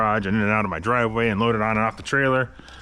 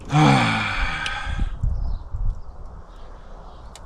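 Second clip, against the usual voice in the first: long frustrated sigh; heavy sigh; 2 semitones lower than usual. Real, not acted. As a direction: let out a long weary sigh. Frustrated with rusted bolts.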